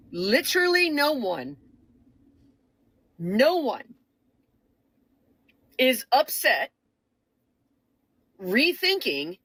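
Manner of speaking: slow, deliberate, each phrase set apart; real slow. Real, not acted. Telling liberals on this app to pay attention, real slow. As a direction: spell it out word by word with long pauses. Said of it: "I'm gonna say it real slow".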